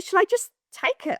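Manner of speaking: high, keen imitation of the caller; eager retold question. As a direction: pitch it right up, imitating his eagerness. Partner asks urgently, retold.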